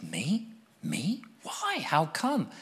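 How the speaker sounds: soft, startled questions; young man stunned at the offer; astonished. Retold or imitated. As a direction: caught off guard, disbelieving. Stunned by mentor's invitation.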